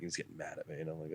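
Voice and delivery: normal talking voice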